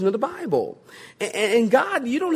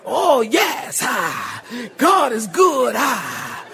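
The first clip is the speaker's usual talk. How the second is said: shouted phrases, each capped with "ah"; mock preacher voice; much slower than usual. Preacher imitates pulpit talk in everyday life, shouted cadence. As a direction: exaggerate a revival preacher's gasping cadence. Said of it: "you change the whole voice".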